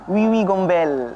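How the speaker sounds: wiwi gombel said slow with French vowels; Frenchified ghost name; French accent. Shown as a demonstration. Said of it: "kalau bahasa Perancisnya?".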